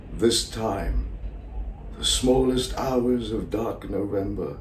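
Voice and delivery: hushed late-night confession with long pauses; quiet, halting theatrical soliloquy; lonely Salieri, confiding. Acted. Rehearses monologue in character.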